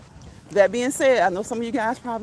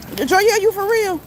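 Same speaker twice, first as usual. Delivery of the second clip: surprised; 9 semitones higher, 5 dB louder than usual. Real, not acted. Hearing of a broken toe.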